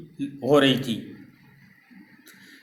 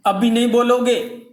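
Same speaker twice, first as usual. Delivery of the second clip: raised, louder threatening demand; angry shout; 6 semitones higher, 4 dB louder than usual. Acted. Author to the silent boy, demanding an answer: angry shout. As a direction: bark it at a stubborn child.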